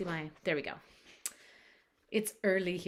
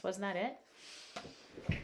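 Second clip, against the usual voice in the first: usual speaking voice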